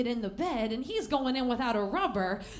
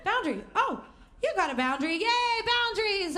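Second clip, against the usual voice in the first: mock cheer; 7 semitones higher than usual.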